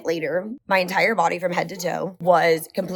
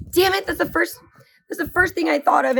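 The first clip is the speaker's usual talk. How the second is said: higher, cursing her own oversight; exasperated at herself; 10 semitones higher than usual. Retold or imitated. Wife told to take Benadryl, high self-annoyed outburst. Annoyed at missing obvious fix.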